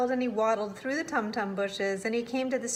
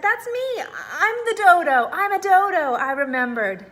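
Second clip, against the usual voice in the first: high, loud, joyful cries; thrilled discovery; 8 semitones higher, 8 dB louder than usual. A narrator's reading. The dodo suddenly remembers who it is, high and joyful. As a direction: burst out with delight.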